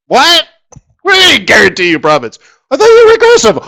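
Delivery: mock-shout in disbelief; retold baffled cry, fast and loud; incredulous outburst.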